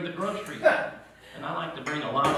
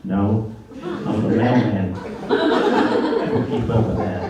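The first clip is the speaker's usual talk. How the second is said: slow dopey mailman; 4 semitones lower, 6 dB louder, slower than usual. Retold or imitated.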